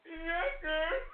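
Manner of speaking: high, thin, timid yes sir from a different voice; squeaky engineer. Acted. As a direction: answer the boss meekly in a tiny high voice. Engineer answers again.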